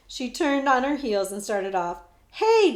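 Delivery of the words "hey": high, loud call after her; boy shouting after the girl. Acted. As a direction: shout to stop her leaving. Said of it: "Jemmy shouted".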